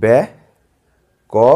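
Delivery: ব্যা-ক-রণ very slowly, each syllable held and separated by pauses; ব্যা-ক-রণ broken into three long, separate syllables. Shown as a demonstration. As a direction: stretch ব্যা-ক-রণ into three slow beats. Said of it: "আস্তে আস্তে করে বলছি".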